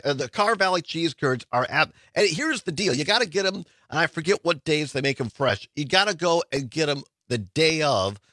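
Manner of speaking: usual speaking voice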